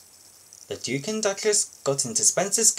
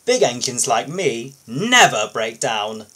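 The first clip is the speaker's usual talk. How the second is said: loud, haughty sneer, slowing at the end; a snooty engine scorning the crane; 8 dB louder than usual. Acted. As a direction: look down your nose and scoff. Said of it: "Spencer sneered".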